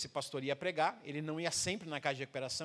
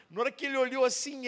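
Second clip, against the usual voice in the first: ordinary talk, nothing marked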